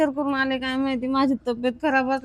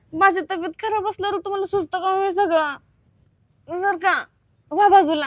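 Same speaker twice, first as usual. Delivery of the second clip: irritated scolding; 6 semitones higher, 4 dB louder than usual. Acted. Sick wife scolding husband away.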